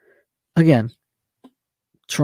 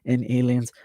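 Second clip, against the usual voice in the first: mocking sceptics; 2 semitones higher than usual. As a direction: scoff like a know-it-all denier, exaggerated. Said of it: "who say".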